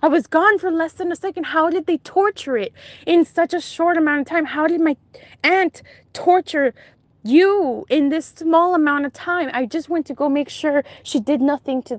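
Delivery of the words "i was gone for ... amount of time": high, agitated questioning pitched well up; stunned disbelief. Retold or imitated. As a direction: frantically work out how it happened. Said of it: "and I just was like".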